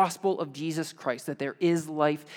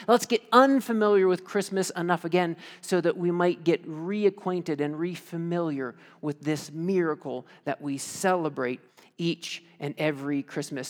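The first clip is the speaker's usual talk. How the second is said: ordinary delivery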